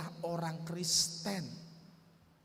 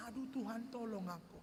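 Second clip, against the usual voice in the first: much softer, pleading plea to God; frightened prayer; 2 semitones higher, 7 dB quieter than usual. Retold or imitated. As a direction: breathe it out in panic, quietly. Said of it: "Saya jantungan". (doa ketakutan yang lirih dan jauh lebih pelan)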